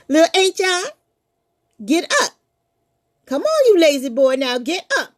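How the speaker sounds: loud mother calling through the door; mama calling. Acted. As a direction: call the lazy boy up. Mama knocks, calls.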